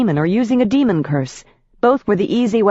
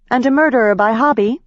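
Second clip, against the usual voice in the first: accusing; 7 semitones higher, faster than usual. A narrator's reading. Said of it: "I accused".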